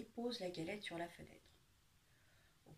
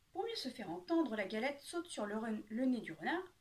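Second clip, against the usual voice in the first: ordinary delivery, nothing marked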